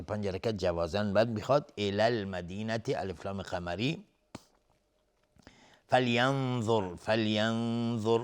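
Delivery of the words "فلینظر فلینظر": slow "فلینظر" with a long nasal n; true ikhfa. Shown as a demonstration. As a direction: hum through the n of "فلینظر" before the next consonant. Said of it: "نون ساکن بهش ذال اخفای حقیقی".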